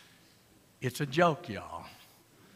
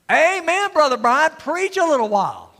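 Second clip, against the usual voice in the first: excited amen; 9 semitones higher, 7 dB louder than usual. Real, not acted. Exuberant response.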